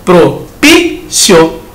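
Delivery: "propício" drawn out very slowly, each sound held; slow teaching "propício"; stretched out slowly. Shown as a demonstration. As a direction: drag "propício" out so every syllable is heard. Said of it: "Na palavra propício".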